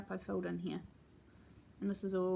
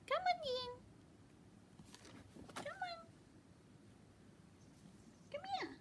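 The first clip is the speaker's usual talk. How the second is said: much higher cooing pet voice; pet talk; 14 semitones higher, 4 dB louder than usual. Real, not acted. Greeting her cat in a high cooing pet voice.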